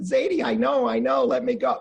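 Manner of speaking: put-on high-pitched squirming little kid; pleading child. Six-year-old to grandfather from a leg lock, high pleading. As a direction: imitate a six-year-old begging to get free.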